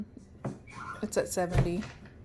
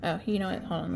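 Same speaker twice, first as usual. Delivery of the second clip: ordinary talk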